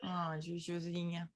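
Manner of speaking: soft, slow, low sympathetic coo; quiet affectionate commiseration; tender sympathy. Real, not acted. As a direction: console her gently, drawing the words out. Comforts friend who has a cold.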